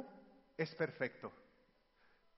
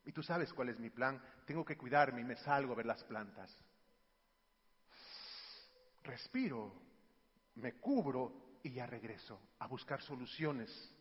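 low, soft, measured explanation; calm father; 9 semitones lower, 6 dB quieter than usual. Retold or imitated. Father telling son his plan to cool off, calm. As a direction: explain it quietly to your child. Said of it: "Y yo le digo".

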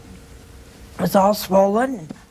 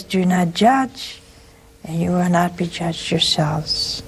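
slow quote; 2 semitones lower, slower than usual. Retold or imitated. Quoting the Lord's words.

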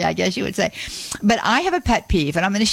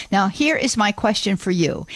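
ordinary talk

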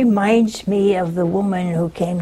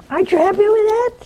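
"aren't you happy with that" pitched much higher and fast, with a teasing rise on "that"; mock innocent question, much higher; 12 semitones higher, much faster than usual. Priest needling the bitter widow, high and fast. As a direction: push the question up high and quick, feigning innocence.

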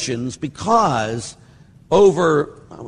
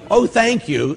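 mock gratitude; 9 semitones higher, 5 dB louder, slower than usual. Sarcastic retold reply to bad news.

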